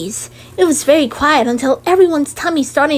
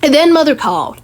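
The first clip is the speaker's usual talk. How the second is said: ordinary delivery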